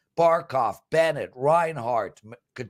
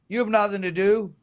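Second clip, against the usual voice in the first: ordinary talk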